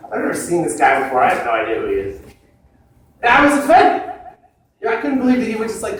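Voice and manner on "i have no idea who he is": ordinary talk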